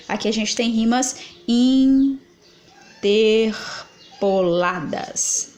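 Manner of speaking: very slow, leaning hard on rimas; slow emphatic repeat. Shown as a demonstration. Teacher repeating for students, slow and emphatic. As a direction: spell it out slowly so the class gets it. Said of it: "tá vendo?".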